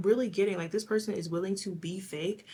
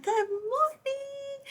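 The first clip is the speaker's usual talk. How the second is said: drawn-out high good morning; a Sagittarius trainer greeting the room, loud and high; loud sing-song greeting; much slower than usual. Retold or imitated. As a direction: belt out a trainer's hello. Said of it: "she would be so loud".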